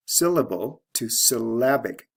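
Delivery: "syllable" stressed on SYL, "syllabic" on LAB; teacher contrasting SYL in "syllable" with LAB in "syllabic"; stress shift demo. Shown as a demonstration. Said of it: "stress shift from first syllable to second".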